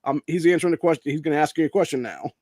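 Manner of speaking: hammed-up defiant yell at the press; brash shout. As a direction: shut the reporter down and point to the next one. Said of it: "I'm like".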